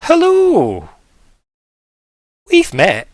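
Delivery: high, loud, drawn-out greeting; sloth character. Acted. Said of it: "and goes, goes".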